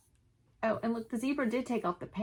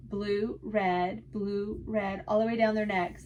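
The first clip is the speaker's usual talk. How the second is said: ordinary delivery, nothing marked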